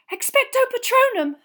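very high, loud incantation; desperate shout. A narrator's reading. Harry fighting off Dementors, shouting desperately.

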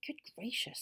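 put-on mildly alarmed girl in a story; frightened. A narrator's reading. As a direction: say it with a touch of fright. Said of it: "looking rather frightened".

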